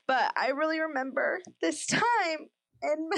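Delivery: fighting tears while remembering; getting emotional. Real, not acted. Recalling baby blues to her co-host, close to tears. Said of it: "Sorry, I'm gonna get emotional".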